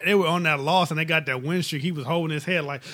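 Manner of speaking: normal talking voice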